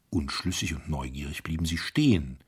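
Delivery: usual speaking voice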